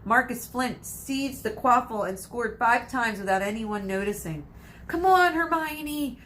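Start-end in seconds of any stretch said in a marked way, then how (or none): 4.9-6.2 desperate mutter. Acted. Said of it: "Ron muttered desperately".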